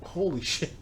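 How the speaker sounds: ordinary talk, nothing marked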